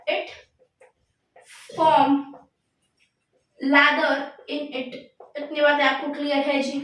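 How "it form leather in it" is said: slow dictation with long gaps. Real, not acted. Teacher dictates the last note to students, slow dictation. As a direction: repeat the words singly for note-takers.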